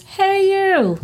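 high quoting voice. Retold or imitated. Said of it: "that's what she says".

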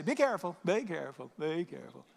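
breezy repeated caution, trailing downward; light, casual warning. Acted. Father throwing his son the truck keys, casual. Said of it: "I didn't say that".

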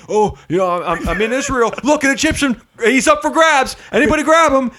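high, loud mock voice of a gloating slaver; mocking caricature. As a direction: ham up a gloating Israelite grabbing slaves. Mocking a misreading of scripture.

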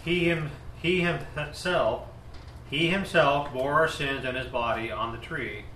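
usual speaking voice

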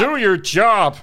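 loud, fuller-voiced impression; Jordan Peterson. Shown as a demonstration. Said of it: "Yours much deeper baritone".

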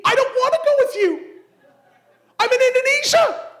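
high, loud, fast panicked plea; panicked protest. Retold or imitated. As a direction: squeal it out in a panic. Panicked reply to the officer.

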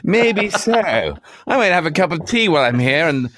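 a cocky retort in a put-on voice; mock smug comeback. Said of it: "I like the delivery on that one".

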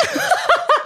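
squealing laugh; high laugh. Real, not acted. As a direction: burst into a high giggle. Laughs at own accent.

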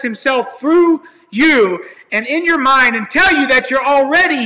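high, loud, fervent preacher declaring healing; preaching at full force. Acted. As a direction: lift it up and proclaim like a pulpit. Preaching about the great one within.

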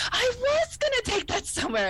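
high, dreamy realization; Beverly voice. Retold or imitated. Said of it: "And she goes".

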